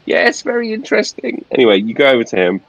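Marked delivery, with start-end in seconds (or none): 0.0-1.5 steward voice. Acted. GM keeps steward voice.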